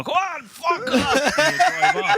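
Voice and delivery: high, loud, slow and laughing; cop waving him off. Retold or imitated. Guard letting the comedian drive off, high and loud laughing. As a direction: wave him on like a chuffed guard. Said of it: "and then they go".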